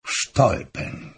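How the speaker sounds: slow, hushed, breathy repetition; brooding murmur, drawn out; repeated musingly. Acted. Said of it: "powtarzał kilka razy".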